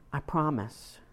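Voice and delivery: usual speaking voice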